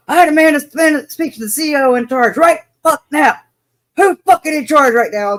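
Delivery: loud, high furious father demanding; angry dad. Retold or imitated. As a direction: storm in and demand the officer. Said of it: "you can hear full force".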